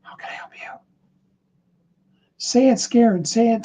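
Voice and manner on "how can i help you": barely audible whisper to someone else; faint whisper. Shown as a demonstration. Coach shows whispering the question to someone else. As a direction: let the whisper almost vanish. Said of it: "You can whisper to somebody else".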